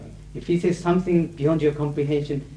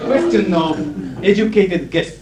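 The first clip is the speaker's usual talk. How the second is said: laughing; 7 semitones higher, 5 dB louder, slower than usual. Real, not acted. Laughing after joke.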